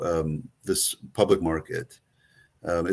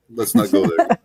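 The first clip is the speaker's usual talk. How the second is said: high laughing voice; 12 semitones higher, 5 dB louder than usual. Real, not acted. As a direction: laugh while saying it. Laughing while waving off the joke.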